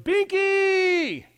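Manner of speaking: very high, loud shout of a pet name; high-pitched excited yell of a nickname. Retold or imitated. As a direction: holler it across the room like a delighted relative. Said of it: "if they just yelled".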